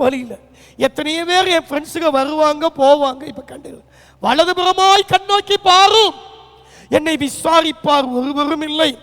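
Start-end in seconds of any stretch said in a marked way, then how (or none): 4.2-8.9 lament. Acted. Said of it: "தாவீதை போல நீங்களும் நானும் புலம்பலாம்".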